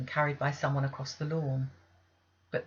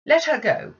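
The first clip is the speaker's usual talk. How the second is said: curt order; 10 semitones higher, 11 dB louder than usual. Acted. Mistress's cold order.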